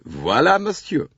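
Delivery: lightly voiced showy French conductor; a touch of a proud attendant presenting the berth. A narrator's reading. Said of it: "The conductor displayed".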